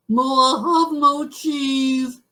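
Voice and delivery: high, loud, drawn-out mock lament; hammed-up moose wail. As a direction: wail slowly like a heartbroken cartoon moose. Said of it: "He was very sad".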